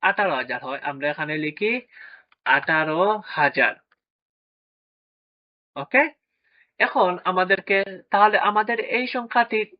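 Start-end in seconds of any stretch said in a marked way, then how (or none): 2.4-3.7 slow, deliberate. Shown as a demonstration. Teacher dictating the answer to pupils, slow and deliberate. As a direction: spell out আঠারো হাজার slowly, word by word.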